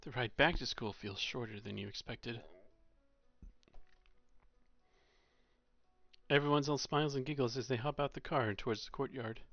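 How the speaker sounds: calm read-aloud narration voice; story narration. A narrator's reading. Streamer narrates the visual novel to viewers, calm and even. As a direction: read the scene evenly to the chat.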